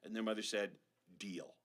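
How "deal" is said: dry mom. Acted. Mom agreeing.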